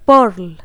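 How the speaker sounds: पर्ल with a clear R, as perl; American pronunciation. Shown as a demonstration. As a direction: sound the R in पर्ल, American style. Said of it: "American accent में". (American उच्चारण, पर्ल में आर के साथ)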